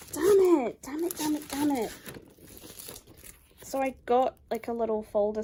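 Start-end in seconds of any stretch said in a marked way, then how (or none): 0.1-1.9 loud, annoyed repeated curse, easing off; frustrated cursing. Real, not acted. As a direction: swear at the mess, fading a little each time. Frustrated over the damaged item.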